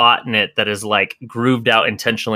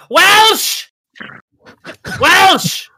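high, loud call down the hall; Agnes yelling; 5 dB louder than usual. Acted. Agnes calls for Welsh across the house, shrill and loud. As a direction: yell the butler's name across the house. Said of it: "she yells".

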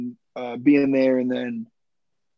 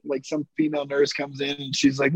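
ordinary talk, nothing marked

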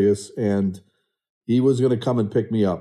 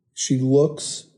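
ordinary talk

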